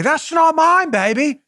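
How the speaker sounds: high-pitched comic denial; mock a denial in a silly high voice; Austin Powers. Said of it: "he's just gonna say".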